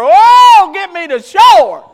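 loud, high cry to be saved; hollering out from the water to shore; desperate call. Acted. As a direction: shout it like you're sinking. Disciple calling out from the water.

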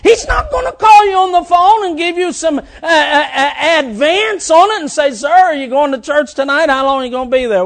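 shouted, rising preacher's cry; fiery preaching. Acted. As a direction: preach it out loud to the congregation. Preaching at full force.

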